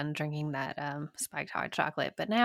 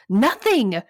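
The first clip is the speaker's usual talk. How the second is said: sudden shouted word, much louder; screamed; 3 semitones higher, 11 dB louder than usual. Retold or imitated. Wife yelling at her Apple Watch, loud shout. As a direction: yell it at the watch in frustration. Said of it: "And I screamed".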